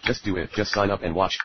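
ordinary talk

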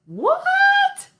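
very high surprised "What?"; surprised squeak. Acted. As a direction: squeak it in mock shock. Silly burrito line.

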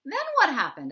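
high, loud and eager question; eager Mouse. A narrator's reading. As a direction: beg the storyteller to go on. Mouse, eager listener in story.

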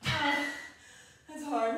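loud, strained exhale of effort; straining groan. Real, not acted. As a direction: push the words out through the strain. Straining at the end of a set.

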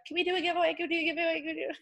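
high, small mimicking voice, repeating eagerly; pestering followers. Imitating people pestering her by message.

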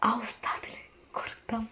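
a whispered plea to God; hushed, pleading whisper; whispered prayer. Acted. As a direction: whisper it, almost to herself. Mother's heartbroken prayer to God.

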